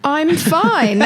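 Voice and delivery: high, comically strained, tipping into laughter; mock fine. Guest answers how they are in January, high and laughing. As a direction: insist on it far too high, then crack up.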